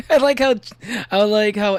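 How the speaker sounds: high, loud burst; excited outburst. Real, not acted. Sudden outburst after laugh.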